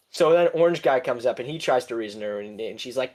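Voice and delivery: ordinary talk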